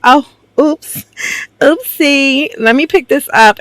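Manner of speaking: high-pitched mock-innocent act; feigned oops. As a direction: play up a fake little accident, high and cutesy. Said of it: "goes to act like".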